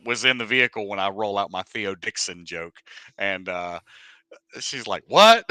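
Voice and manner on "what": high, loud startled question; surprised. Retold or imitated. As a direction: snap it out in disbelief. Whitney's stunned reaction.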